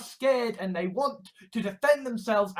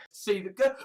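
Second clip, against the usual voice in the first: normal talking voice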